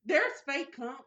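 surprised. Real, not acted. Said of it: "Yes, it's fake cum shit".

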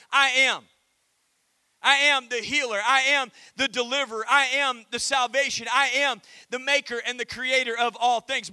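loud, proclaiming each "I am"; booming declaration. Acted. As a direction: declare God's titles with full force. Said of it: "God declares his ultimate title".

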